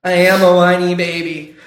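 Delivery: put-on whining like a baby; whiny. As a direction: whine it like a spoilt kid. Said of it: "Why do I sound so whiny?".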